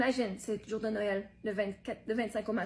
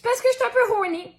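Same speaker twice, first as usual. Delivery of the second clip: loud blurt; 10 semitones higher, 6 dB louder than usual. Acted. Blurts out a confession.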